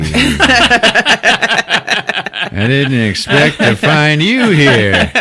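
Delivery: slow, high, loud hammy seduction; hammed-up flirting. Podcaster voicing the couple flirting, hammy seduction. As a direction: drag it out like a bad romance line.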